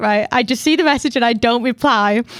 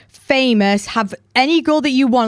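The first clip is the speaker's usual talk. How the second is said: ordinary delivery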